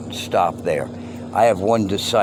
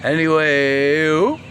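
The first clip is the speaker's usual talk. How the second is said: "Anyway" stretched slow, high and loud; drawn-out anyway; 7 semitones higher, 7 dB louder, much slower than usual. Real, not acted. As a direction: sing out "Anyway" long and high.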